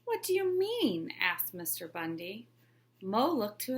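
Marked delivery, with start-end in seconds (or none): 0.0-1.1 much higher, eager question; a principal caught by the trick; intrigued principal. Acted. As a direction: pitch it up, hooked.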